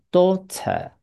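British-style "daughter", tongue uncurled at the end. Shown as a demonstration. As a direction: model "daughter" with a clear T and silent R. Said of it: "英国人就是念daughter". (英国腔 daughter，不卷舌)

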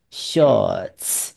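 "shorts" as a long "shawts", R silent; British shorts. Shown as a demonstration. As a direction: model British "shorts" without curling the tongue. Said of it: "对，不用卷舌". (英国腔 shorts，不用卷舌)

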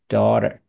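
"daughter" with its T softened to a D-like tap; American-style "daughter", T barely tapped; American flapped T. Shown as a demonstration. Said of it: "听到美国人喜欢念daughter". (美国人念的 daughter，T 变音)

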